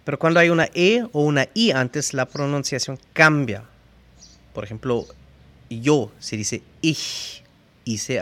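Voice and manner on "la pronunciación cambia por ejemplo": ordinary talk, nothing marked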